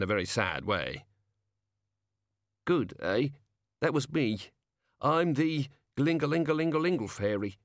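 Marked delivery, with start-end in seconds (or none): 2.6-7.6 gnome showing off, a touch higher; lightly voiced gnome. Gnome introduces itself as a bell fairy, lightly voiced and eager. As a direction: present yourself as a hopeful little gnome.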